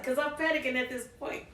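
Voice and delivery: ordinary delivery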